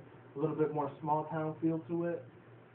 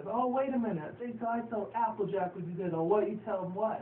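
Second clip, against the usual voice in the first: high, flustered little-filly voice; a hint of a panicky kid; lightly voiced Apple Bloom; 6 semitones higher than usual. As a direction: play a worried little pony. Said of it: "Apple Bloom tries to say".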